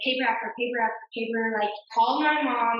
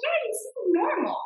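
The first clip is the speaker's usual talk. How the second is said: mock husband reply, pitched way up; imitating husband; 10 semitones higher than usual. Husband shrugging off her drugged state, pitched high in mockery. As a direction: toss it off lightly in a high mock voice.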